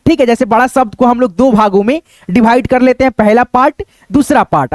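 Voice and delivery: ordinary delivery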